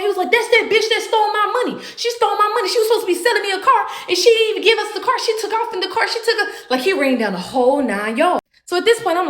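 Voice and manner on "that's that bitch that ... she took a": high, agitated mock yelling; high-pitched complaint pouring out; yelled accusation. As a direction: imitate an outraged accuser ranting in a high pitch. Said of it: "what are you yelling about".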